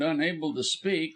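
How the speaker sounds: usual speaking voice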